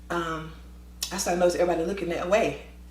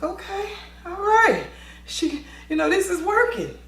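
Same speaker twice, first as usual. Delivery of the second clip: amused relief; 11 semitones higher than usual. Retold or imitated. Relief, inner amused thought.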